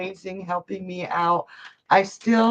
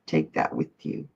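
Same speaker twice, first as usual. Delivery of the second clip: soft unvoiced whisper, much lower; 5 dB quieter than usual. Real, not acted. Whispering a parting blessing to a grieving viewer. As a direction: breathe it out like a secret blessing. Repeats comforting phrase to viewer.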